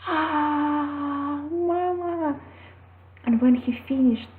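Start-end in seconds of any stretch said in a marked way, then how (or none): 0.1-2.4 put-on high wailing child's cry of Mama. Son sobbing out to his mother, high wailing cry. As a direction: let the small boy cry out for his mother.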